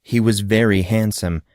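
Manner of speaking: plain reading voice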